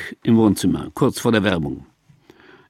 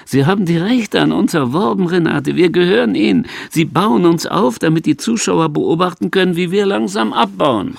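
rising agitation; 7 semitones higher, 4 dB louder than usual. Acted. Agitated explanation to Renate.

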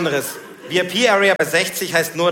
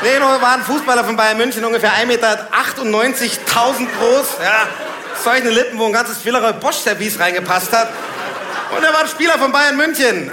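loud, raised-pitch comic rant; loud incredulity; 7 semitones higher, 6 dB louder than usual. Real, not acted. Comedian describing the footballer he was mistaken for, loudly incredulous. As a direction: belt it out in disbelief.

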